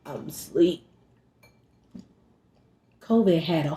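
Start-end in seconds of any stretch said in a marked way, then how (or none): 0.0-0.8 low, soft, croaky just-woke-up voice; groggy monster voice. Retold or imitated. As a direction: answer the phone like a half-dead monster. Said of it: "sounding like a monster".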